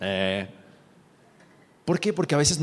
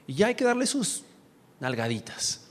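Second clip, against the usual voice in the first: usual speaking voice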